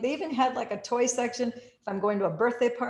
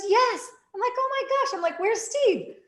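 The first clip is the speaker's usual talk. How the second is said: much higher, loud excited reunion; 6 dB louder than usual. Retold or imitated. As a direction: squeal with surprise.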